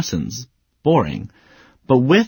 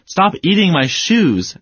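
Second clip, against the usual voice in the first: higher, slow, drawn-out scolding; upset yelling; 7 semitones higher, slower than usual. Shown as a demonstration. Owner yelling at the dog to stop eating shoes. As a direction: drag out the order to the dog. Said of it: "I might also be upset and say".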